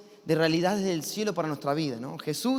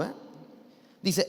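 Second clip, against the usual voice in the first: ordinary talk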